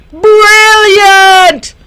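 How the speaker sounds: high, loud excited cry; joyful shout. A narrator's reading. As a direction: shout it out with delight. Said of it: "shouted Millie and Tilly".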